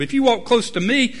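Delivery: ordinary talk, nothing marked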